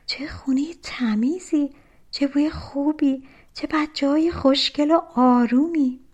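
high, hushed wonder, growing fuller; amazed murmur. A narrator's reading. Mother returns to a clean house, murmuring in amazement. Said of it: "زیر لب گفت".

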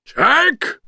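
high, startled monster cry; villain's astonished yell; Oogie Boogie shouting. A narrator's reading. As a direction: shout the name in shock. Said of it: "shouted Oogie Boogie".

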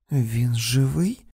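slow, aching question; slow anguished whisper of a question; pained question. A narrator's reading. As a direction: ask it as if dreading the answer. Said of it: "невимовний біль, із яким воно було сказане".

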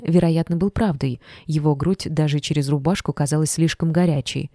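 plain reading voice

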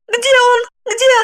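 very high, agitated repeated questions; frantic muttering. A narrator's reading. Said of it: "Она, бормоча". (лихорадочно, высоким голосом)

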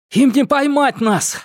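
high triumphant yell; shouting. Acted. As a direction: shout it while running. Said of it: "прокричал Томас". (торжествующий крик)

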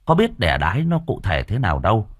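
plain reading voice

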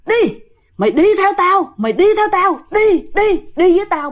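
high, insistent acted order; fierce ghost command. Dead sister pulling her elder sister away, fierce command. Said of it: "Mà dữ lắm nha".

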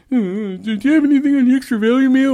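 high, whiny customer voice; mock a cheapskate asking for a deal. Fast food worker mocking a cheap customer, whiny and high.

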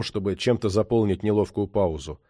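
usual speaking voice

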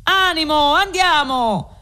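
high, effortful cry under a heavy load; rallying shout. A narrator's reading. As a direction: urge the others on while lifting. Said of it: "se lo mettono addosso gridando". (grido d'incoraggiamento sotto sforzo)

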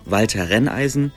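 usual speaking voice

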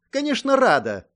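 high, loud protest; offended exclamation; indignant. A narrator's reading. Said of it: "возмутилась Мэй".

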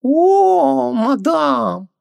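very high, long swooning exclamation; as if asked to sell a beloved son; theatrical anguish. A narrator's reading. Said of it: "словно ему предложили продать любимого сына".

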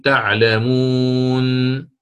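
"تعلمون" drawn out very slowly, final نون stopped silent; stop with sukoon. Shown as a demonstration. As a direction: stretch "تعلمون" slowly and close on a still نون. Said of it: "كقولك عند الوقف على تعلمون تقف".

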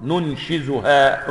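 "ننشزها" بزاي واضحة ببطء; أبرز الزاي في "ننشزها"; بالزاي. Shown as a demonstration. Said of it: "بالزاي المنقوطة". ("ننشزها" بالزاي المنقوطة ببطء)

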